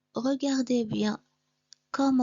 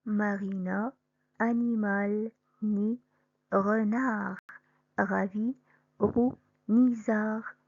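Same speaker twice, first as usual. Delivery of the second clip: slow, clear model reading of a word list; teacher reading a word list; careful word reading; slower than usual. Shown as a demonstration. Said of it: "écoutez bien".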